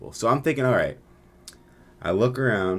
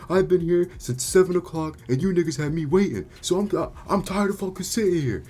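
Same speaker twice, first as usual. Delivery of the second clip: exasperated complaint; 11 semitones higher than usual. Retold or imitated. Patient tired of waiting since seven o'clock, exasperated. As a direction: imitate a fed-up patient whining in protest.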